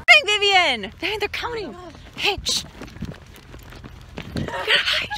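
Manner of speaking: excited hushed hiding call; urgent whisper. Pretend. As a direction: hurry her into hiding. Hiding game in maze.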